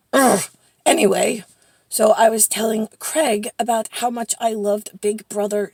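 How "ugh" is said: short, loud "ugh"; disgusted little groan; exasperated grunt. Real, not acted. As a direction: grunt it out, fed up. Still exasperated about trouble.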